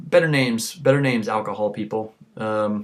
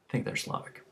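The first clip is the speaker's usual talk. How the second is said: whisper; 14 dB quieter, faster than usual. Real, not acted. Muttered aside.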